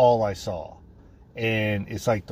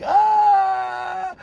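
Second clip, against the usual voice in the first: high, wild scream of joy; 5 dB louder than usual. Retold or imitated. Prisoner screaming out the door over the ruling. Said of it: "started screaming out the door".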